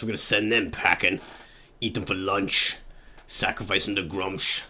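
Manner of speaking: lightly growled orc warlord; a hint of a brutal orc chief; gruff orc voice. Orc warlord voice for his plans.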